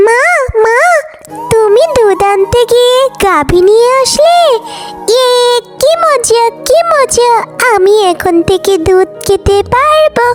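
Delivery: put-on very high-pitched kid's character voice; excited little child. Fox's child greeting mother with the cow, excited. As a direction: squeal with delight, then question Mum.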